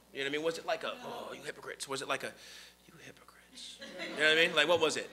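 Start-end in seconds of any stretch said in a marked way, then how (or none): 1.0-1.9 a soft, sneering "Oh, you hypocrites"; soft mocking tone. Shown as a demonstration. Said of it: "what was Jesus' tone?". 2.9-3.8 a hushed whispered "You hypocrites". Shown as a demonstration. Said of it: "what was Jesus' tone?".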